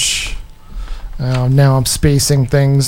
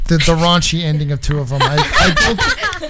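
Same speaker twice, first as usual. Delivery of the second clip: laughing. Real, not acted. Embarrassed over raunchy endings.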